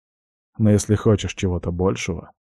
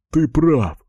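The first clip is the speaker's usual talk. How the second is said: excited shout; 7 semitones higher, 3 dB louder than usual. Acted. Drunk friend excited outburst.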